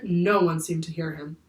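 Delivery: ordinary delivery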